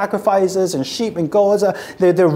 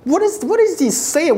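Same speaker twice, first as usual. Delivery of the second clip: incredulous cry; 8 semitones higher than usual. Retold or imitated. Worshipper reacting to prophet.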